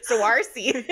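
put-on laughing take on a Wisconsin accent; mock Wisconsin accent. Joking that someone from Wisconsin would say it, mock Wisconsin accent. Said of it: "If you're from Wisconsin".

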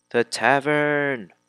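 loud, high, drawn-out exclamation; mock proclamation. Player hunting for the tavern, drawn-out mock proclamation. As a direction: proclaim it with playful overemphasis. Exclaims the destination while searching.

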